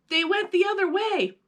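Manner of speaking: put-on high, urgent boy's call; shouting. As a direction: point it out in alarm. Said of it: "Max shouted".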